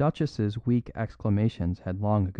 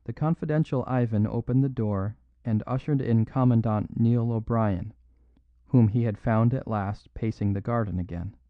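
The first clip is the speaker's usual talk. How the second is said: usual speaking voice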